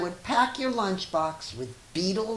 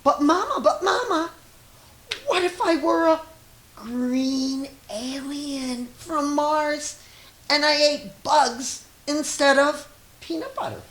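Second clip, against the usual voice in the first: put-on high child voice, insistent; a little kid begging Mama with big what-ifs; high, pleading little child; 10 semitones higher, 6 dB louder than usual. A narrator's reading. Child voice in read picture book.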